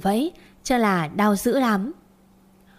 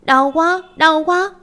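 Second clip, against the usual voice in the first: put-on much higher, louder child's pained wail; crying in pain; 7 semitones higher, 5 dB louder, slower than usual. Little Su after falling off the cabinet, wailing in pain. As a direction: cry out like a little girl who just broke her arm. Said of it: "khóc loạn lên".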